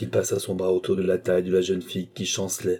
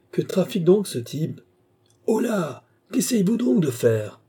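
higher-pitched, startled questioning; puzzled exclamation; 10 semitones higher than usual. A narrator's reading. Passenger puzzled by what the man above is doing, startled questioning. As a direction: call out in puzzlement at what he's up to.

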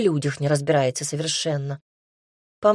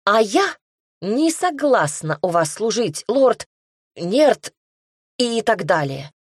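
defiant refusal; 4 semitones higher, 4 dB louder, slower than usual. A narrator's reading.

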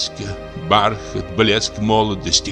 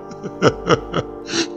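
high, despairing laugh; drunken, hysterical chuckling; bitter laughter; 11 semitones higher, 4 dB quieter than usual. Acted. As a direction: laugh at his own misery. Bitter laughter amid drunken lament.

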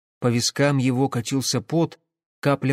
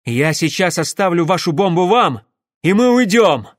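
loud, raised declaration; shouted ultimatum; 5 semitones higher, 7 dB louder than usual. Acted. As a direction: lay down terms at the top of your voice. Shouted ultimatum while holding bomb. (громкий ультиматум)

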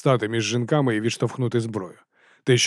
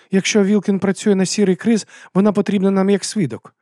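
high, louder persuasive voice; insistent woman arguing; 8 semitones higher, 5 dB louder than usual. A narrator's reading. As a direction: press the argument in a raised voice. Abena argues to spare prisoner.

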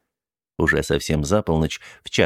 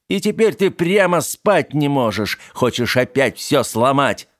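furious rant; 7 semitones higher, 3 dB louder than usual. Acted. Charlie rages at Teddy.

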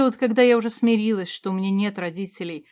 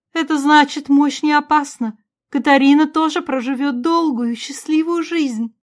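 high, louder, emotional voice; joyful queen, pitched up and insistent; hopeful mother; 6 semitones higher, 7 dB louder than usual. A narrator's reading. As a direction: play a mother seizing on good news.

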